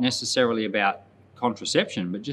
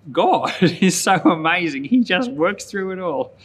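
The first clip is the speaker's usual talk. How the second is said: higher and louder, laughing with joy; laughing delight; 7 semitones higher, 6 dB louder than usual. Real, not acted. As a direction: break into a laugh of wonder over God. Pastor marvels at God.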